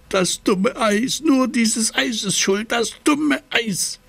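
high, slow frozen grumble; grumbling. Acted. Frozen Seppel grumbling at the ice, high and slow. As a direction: complain through chattering cold. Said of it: "er knurrt".

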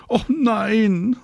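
very high, drawn-out protest; calling out. A narrator's reading. Marie protests to Mama on the balcony, calling out. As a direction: wail back in a child's objection. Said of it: "ruft Marie".

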